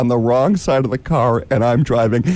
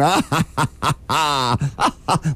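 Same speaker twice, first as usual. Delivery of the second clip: mock laughing cabbies; 3 dB quieter than usual. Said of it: "laughing at him".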